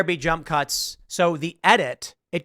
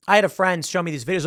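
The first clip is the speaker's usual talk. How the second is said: ordinary talk, nothing marked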